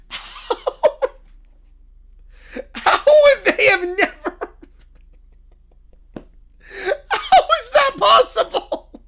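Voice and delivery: high, loud, helpless laughter through words; laughing hard. Real, not acted. Baffled by Jaguars playing on moon, laughing uncontrollably. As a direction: squeal it out while cracking up. Losing it over moon game.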